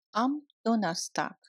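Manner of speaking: usual speaking voice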